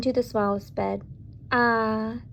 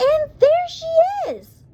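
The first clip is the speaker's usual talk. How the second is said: very high, loud, drawn-out squeal; squeaky Baby Bear; 6 dB louder, slower than usual. A narrator's reading. Baby Bear discovers the sleeping girl, high squeaky voice. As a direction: squeal it slowly in a tiny bear's voice. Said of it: "Baby Bear's high, squeaky voice".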